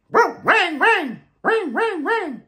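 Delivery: very high, yappy barks; high dog yips. A narrator's reading. Reading to kids, yapping like a Chinese dog. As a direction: yap like a tiny excited dog. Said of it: "Dogs in China bark".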